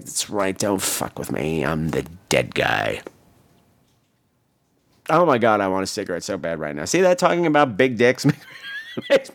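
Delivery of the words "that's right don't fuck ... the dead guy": low, slow mock-menacing swagger; tough-guy boast. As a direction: drop it low and slow like a movie hardman. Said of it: "I was like".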